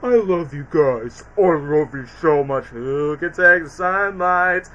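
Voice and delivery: slow, low and woozy; dazed trip voice. Retold or imitated. Kid retells Alex's tased trip, slow dazed voice. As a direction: drift through it like he's drugged. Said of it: "acid trip sequences where he goes".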